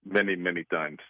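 lightly put-on Greek accent, disguising his voice; mystery guest hides his real voice behind a Greek accent. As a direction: caller playing a guessing game, in a light fake Greek accent. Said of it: "That was my new Greek accent".

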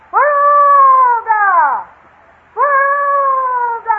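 long drawn-out jungle cry, slow; jungle call. Shown as a demonstration. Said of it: "permit me to demonstrate".

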